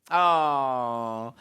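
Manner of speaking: long, loud, falling "aww"; sympathetic aww. Real, not acted. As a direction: coo in sympathy, drawn out. Sympathy at homecoming story.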